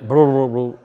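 low, quiet gurgling blah; mock belly rumble. Stomach rumbling at midnight, mock gurgle. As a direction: let the stomach grumble softly.

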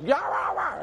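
high-pitched mock barking; dog yapping imitation. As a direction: yap like a neighbor's dog.

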